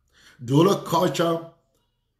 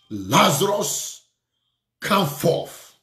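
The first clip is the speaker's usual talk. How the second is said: slow, drawn-out summons, rising on the name; preacher's ringing command; Jesus' commanding call; slower than usual. Retold or imitated. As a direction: call it out to raise the dead. Acting Jesus calling Lazarus, raised take.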